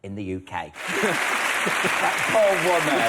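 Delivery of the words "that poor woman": laughing pity. Real, not acted. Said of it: "laughs at sign interpreter".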